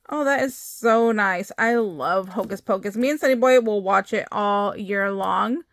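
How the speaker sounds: normal talking voice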